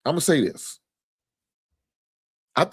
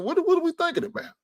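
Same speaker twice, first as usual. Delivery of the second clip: high, fast, disbelieving question; incredulous outcry; faster than usual. Real, not acted. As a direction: cry out in disbelief at the trade decision. Incredulous at a player trade.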